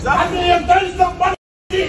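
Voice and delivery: fast, furious yelling; angry shout. Real, not acted. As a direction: yell it out in rage. Angry man yelling at group.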